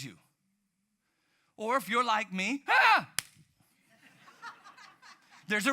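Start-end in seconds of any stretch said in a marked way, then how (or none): 2.7-3.1 high, loud shriek of fright; startled scream. Retold or imitated. Preacher acts out his haunted house scare with a high, loud shriek. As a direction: jump and scream like something grabbed you.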